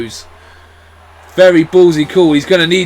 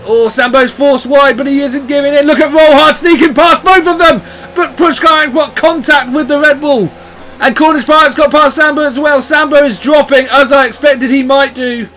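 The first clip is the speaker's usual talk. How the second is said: excited live race call; motorsport commentator; 6 semitones higher than usual. Real, not acted.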